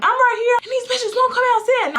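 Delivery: very high mock yell; retold screaming. Girl answering dad while cussing, mock screaming. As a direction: imitate an outraged holler back. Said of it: "He could hear me screaming".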